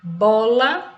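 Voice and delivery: "Bola" loud, with BO punched; stress on first syllable. Shown as a demonstration. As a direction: hit "Bo" loudly in "Bola". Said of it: "temos uma sílaba tônica".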